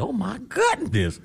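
high, slow, amazed exclamation; mock astonishment. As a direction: gasp it out in exaggerated shock. Mock reaction to long word.

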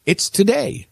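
lightly squeaked, small voice; a hint of a squeaky little pig; squeaky Piglet. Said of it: "squeaked Piglet".

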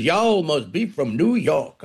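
slow mock Southern drawl; put-on Southern accent, unhurried. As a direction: imitate a Southerner, drawn right out. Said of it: "you get the Southerners".

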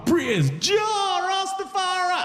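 high, drawn-out praise cry; Rastafari exaltation. Acted. Comedian hailing Jah, mock exultant shout.

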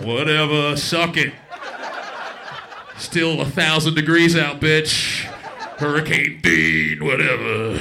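loud, dismissive mock sneering; defiant taunt. The heat taunting a hurricane, loud and dismissive. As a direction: throw it out loudly, unimpressed.

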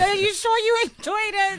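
high teasing question. Real, not acted. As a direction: needle them playfully. Teasing doubt.

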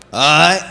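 "All right" squeezed into one word, rising; Southern slurred word. Shown as a demonstration. Comedian showing a favourite word, slurred Southern style. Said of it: "I like this word a lot".

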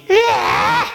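high, slow, stretched yelled yeah; drawn-out holler. Retold or imitated. Preacher imitating the boy's long cry in church, stretched high yell. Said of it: "would be hollering out".

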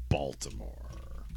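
soft, slow whisper. Real, not acted. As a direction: drop to a whisper. Whispered pick.

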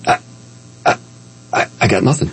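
halting false starts, long gaps between; retold speechless stammering. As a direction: open your mouth and find nothing to say. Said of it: "I actually opened my mouth and went".

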